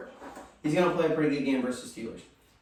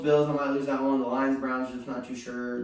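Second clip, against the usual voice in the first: ordinary talk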